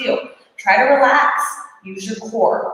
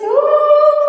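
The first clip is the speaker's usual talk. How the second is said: strained ooh; 6 dB louder than usual. Real, not acted. Burning during exercise.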